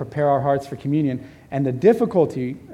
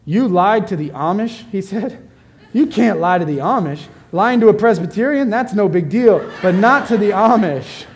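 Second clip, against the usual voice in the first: higher, louder, shocked telling-off; incredulous scolding; 6 semitones higher, 6 dB louder than usual. Retold or imitated. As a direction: scold him like he can't believe it. Colleague scolds him, retold.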